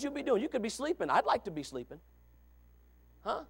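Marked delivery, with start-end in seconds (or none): none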